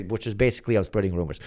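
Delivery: normal talking voice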